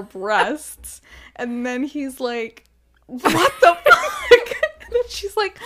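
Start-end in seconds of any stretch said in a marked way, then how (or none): 3.1-4.6 high, loud, drawn-out mock disbelief, breaking into laughter; mock shocked outburst. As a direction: act out his shock, loudly and laughing. Man's shocked reaction in retold scene.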